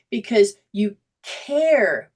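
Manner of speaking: CARE drawn out slowly; slow down and stretch the word care; emphatic answer. Real, not acted. Answers own question with emphasis.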